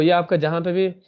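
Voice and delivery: normal talking voice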